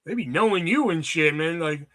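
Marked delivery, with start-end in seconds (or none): none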